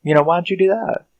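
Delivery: high, indignant voice of a hypocrite; shrill mock outrage at the robot he ordered; mock outraged Josh. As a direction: play the whiny outraged owner. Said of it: "got the nerve to sit there and go".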